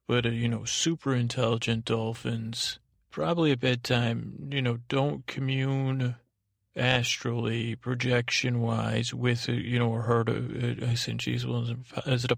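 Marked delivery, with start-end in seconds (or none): none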